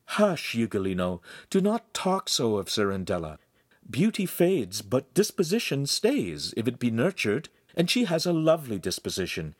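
lightly voiced kindly woman; soothing young woman. A narrator's reading. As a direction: hush and counsel patiently. Voices Giulietta soothing him.

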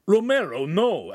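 high, urgent cry of warning; alarmed cry. A narrator's reading. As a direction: cry out to hold him back. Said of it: "Agostino cried".

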